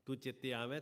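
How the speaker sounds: ordinary talk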